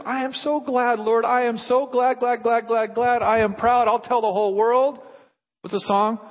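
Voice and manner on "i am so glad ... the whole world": high, elated declaration; glad and proud. Acted. Believer telling the Lord how glad they are, elated. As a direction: pour out gladness insistently. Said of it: "That's what this word is".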